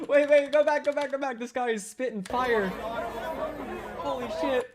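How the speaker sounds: high-pitched, laughing disbelief; amazed excitement. Real, not acted. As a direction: squeal for a replay, blown away. Amazed reaction to the clip.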